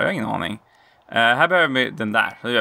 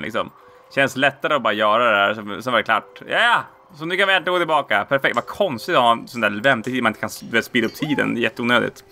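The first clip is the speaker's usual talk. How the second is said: ordinary delivery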